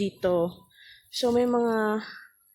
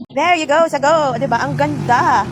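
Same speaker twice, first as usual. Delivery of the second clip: loud, higher proud reveal; proud excited showing; 4 semitones higher, 8 dB louder than usual. Real, not acted. Vlogger showing her harvest to viewers, loud and proud. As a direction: present the harvest with a flourish.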